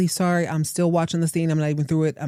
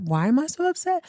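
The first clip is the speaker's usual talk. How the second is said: the same line again, much higher and disbelieving; incredulous repeat; 9 semitones higher than usual. Retold or imitated. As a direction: throw the question back up high in mock outrage. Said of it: "And he's like".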